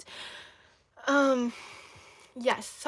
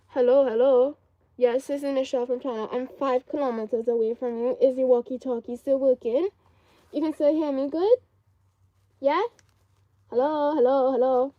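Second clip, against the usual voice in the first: playful walkie-talkie call; 6 dB louder than usual. Pretend. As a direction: pretend radio check, calling out. Pretends shoe is a walkie-talkie.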